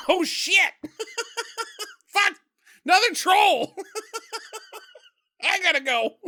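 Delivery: high-pitched, giggling through the words; laughing mock panic. Troll surprised by another troll, high laughing mock panic. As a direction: play the startled troll while cracking up.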